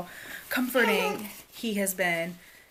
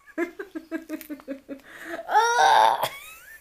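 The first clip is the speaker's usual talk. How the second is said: high breathless laugh; long squealing laugh; 8 semitones higher, 7 dB louder than usual. Real, not acted. Mom laughing helplessly at her toddler, high. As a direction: let the laugh roll out.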